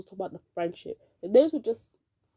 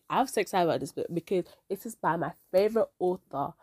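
ordinary talk, nothing marked

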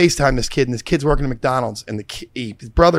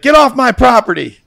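higher, louder shout of get off; angry homeowner; 10 semitones higher, 7 dB louder than usual. Retold or imitated. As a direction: bark at strangers on his lawn. Angry homeowner with beer can.

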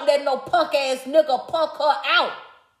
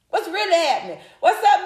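ordinary delivery

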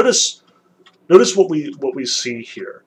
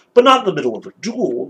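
quick, slightly raised objection; understated shouted interruption; mild shout; 3 semitones higher, 4 dB louder, faster than usual. A narrator's reading. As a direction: protest hurriedly, as a read-aloud shout. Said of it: "my second shouted again".

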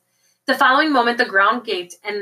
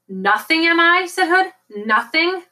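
high, rising, affronted question; loud offended challenge; indignant villain; 8 semitones higher, 4 dB louder than usual. A narrator's reading. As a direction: throw the insult back, pitch climbing.

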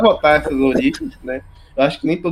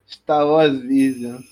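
drawn-out announcement; slow announcer; much slower than usual. Pretend. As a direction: stretch each word like a trailer. Announcing the show title.

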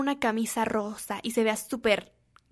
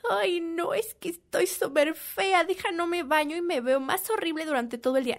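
whiny insecure self; 5 semitones higher than usual. Retold or imitated. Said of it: "ese chip de". (queja lastimera fingida, aguda y suave)

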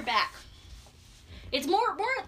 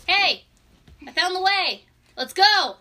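loud, higher hail; calling out; 5 semitones higher, 10 dB louder, slower than usual. Acted. Voice in the dark calling the player over, shouted. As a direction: call it out to a companion. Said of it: "it calls out to you".

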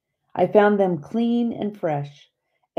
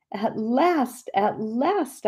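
higher, joyful at last; joyful cry; 7 semitones higher, much slower than usual. A narrator's reading. Daughter greeting her father, joyful cry. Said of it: "I cried".

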